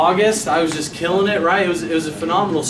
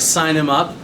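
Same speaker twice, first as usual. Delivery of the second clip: usual speaking voice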